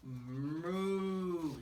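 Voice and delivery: long drawn-out moo in a put-on voice; cow's moo. A narrator's reading. Storyteller mooing as the cow for children, long drawn-out moo. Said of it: "said the cow".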